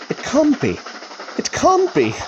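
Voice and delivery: can't believe the ghost train is coming; alarmed disbelief. Acted. Walker sees the train heading at him, alarmed disbelief.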